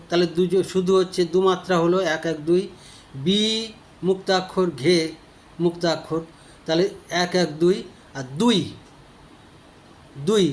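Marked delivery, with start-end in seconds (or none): none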